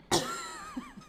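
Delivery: spicy cough. Real, not acted. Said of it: "I'm stun locked".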